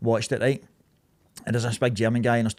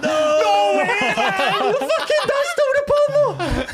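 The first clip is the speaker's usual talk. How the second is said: high, loud shout breaking into laughter; laughing disbelief; 5 dB louder, much slower than usual. Retold or imitated. Boys in back seat realising.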